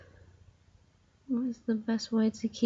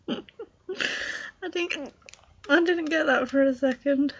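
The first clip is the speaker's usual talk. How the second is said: laughing; 6 semitones higher, slower than usual. Real, not acted. Laughing at the pun joke.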